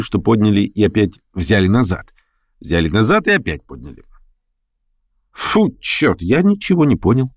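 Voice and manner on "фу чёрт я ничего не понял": higher-pitched exclamation of confusion; mild cry. A narrator's reading. Officer baffled by the vote, mildly crying out. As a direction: exclaim it, only lightly raised. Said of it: "крикнул один офицер".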